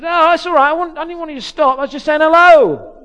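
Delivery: put-on high, loud Cockney kid calling back; Cockney urchin. Cockney kid telling the vicar not to stop, loud urchin voice. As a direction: shout it over like a street urchin. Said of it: "Real Cockney urchin type".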